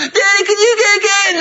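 very high mock kid's begging; whining child. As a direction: pester Dad in a squeaky whine. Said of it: "You're not gonna whine".

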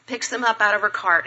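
usual speaking voice

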